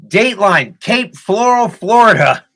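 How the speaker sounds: loud, high newscaster opener; news anchor dateline. Pretend. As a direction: boom it out like TV news. Mock news intro of story.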